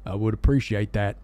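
put-on low, halting old officer. Old officer accepting maple syrup, low and grateful. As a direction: play it low and gallant, stumbling slightly. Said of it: "this old officer's heart".

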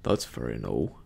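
put-on very low old pirate voice; Morgan. A narrator's reading. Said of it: "said the old man, Morgan".

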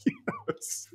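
words breaking into giggles; helpless high laugh while quoting. Real, not acted. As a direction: repeat it through soft laughter. Laughs at the co-host's joke.

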